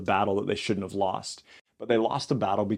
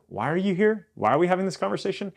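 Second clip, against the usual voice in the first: higher-pitched, mock-baffled questions; incredulous questioning; 8 semitones higher, faster than usual. God to people who come to pray, incredulous. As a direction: ask it in a high, puzzled protest.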